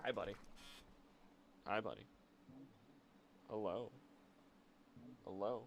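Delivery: soft, tender cooing to the cat. Real, not acted. Streamer coos softly to the cat Whiskey. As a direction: coo gently, close to the mic.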